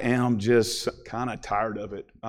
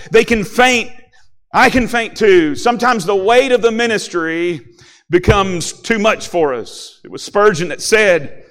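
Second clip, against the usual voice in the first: raised, louder pulpit voice, key words punched; impassioned preaching; 6 semitones higher, 7 dB louder than usual. Real, not acted. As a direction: press the point home to the congregation, building in pitch. Preaches with rising force.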